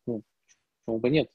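ordinary talk, nothing marked

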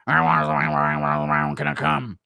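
very low, garbled drawn-out mumble; Stipe Miocic. Acted. Fighter motivating his wife, low slurred mumble. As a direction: drop far down and slur it slowly. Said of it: "impression of Stipe motivating his wife".

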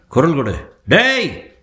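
high, loud sharp hail; calling out. Acted. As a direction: shout out to someone across the dark. Calling out to a man in dark.

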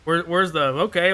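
usual speaking voice

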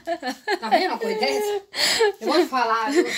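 half laughing, half whining; laughing exasperation. Real, not acted. Mother laughing in disbelief at the naughty cat.

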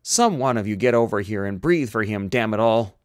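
loud, exasperated demand; yelled order. Acted. Doctor orders crew to help him breathe for patient, yelling. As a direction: bellow for urgent help. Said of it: "he yelled".

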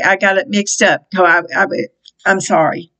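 ordinary talk, nothing marked

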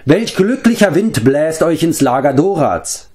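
high, theatrical, grandly false greeting; sneering show of hospitality; mocking welcome. A narrator's reading. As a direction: greet them with exaggerated courtesy. Gang leader mockingly greets strangers.

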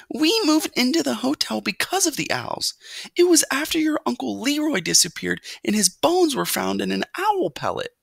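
very high, anxious recounting; pleading mother mouse. A narrator's reading. Mother recalls uncle lost to owls, anxious plea. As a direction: press the warning home in a high mother voice.

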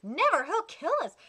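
usual speaking voice

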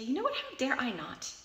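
defiant comeback. Acted. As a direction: talk back to an imagined inner critic. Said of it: "start by talking back to it".

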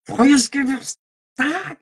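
mock aggressive German, high and barked. Streamer mocking how German sounds, hammed-up harsh barking. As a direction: bark "Freies Geburtstag!" like a harsh German order. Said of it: "in German it sounds like sehr aggressiv".

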